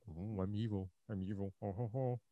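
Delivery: low, soft, hammed-up evil villain; hammy stage actor. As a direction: mutter a lazy villain boast, low and soft. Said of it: "they're just like".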